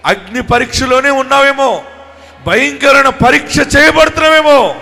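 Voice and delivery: loud, high preaching at full force; fiery preaching. Acted.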